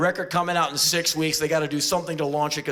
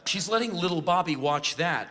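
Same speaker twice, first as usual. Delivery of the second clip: usual speaking voice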